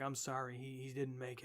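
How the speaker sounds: low, very soft imitation; subdued bad news. Jack tells Holly the man died, quiet and subdued. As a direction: drop right down, breaking it gently.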